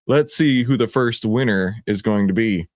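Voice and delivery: ordinary delivery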